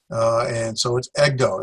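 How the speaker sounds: ordinary talk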